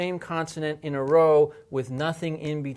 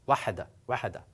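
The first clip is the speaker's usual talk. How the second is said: wahada, wahada rattled off quickly with a single h; very fast; much faster than usual. Shown as a demonstration. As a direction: rush through wahada, wahada. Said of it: "Very, very fast".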